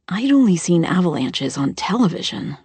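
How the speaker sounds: plain reading voice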